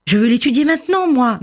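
fast, high, impatient demand; insistent child. Acted. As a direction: press eagerly like an impatient boy. Boy insists to his father. (enfant impatient, vif et aigu)

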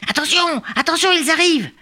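high alarmed lookout call; urgent warning. Acted. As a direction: warn the others they're coming. Lookout warns of attack.

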